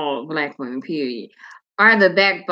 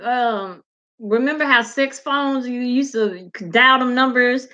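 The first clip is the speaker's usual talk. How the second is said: usual speaking voice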